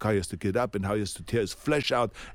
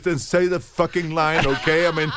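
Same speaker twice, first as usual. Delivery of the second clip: loud, higher, exasperated outburst; angry director; 6 semitones higher, 7 dB louder than usual. Retold or imitated. Director yelling at the actor about the script, angry. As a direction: blow up at the stubborn actor. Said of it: "And he says".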